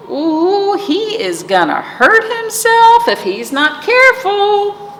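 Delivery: high, fretting sheep voice; worried sheep. A narrator's reading. Sheep worrying about Duck on a bike, high and fretful. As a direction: fuss like a nervous old sheep.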